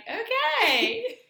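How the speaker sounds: high surprised okay; deadpan okay. Retold or imitated. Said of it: "I'm like".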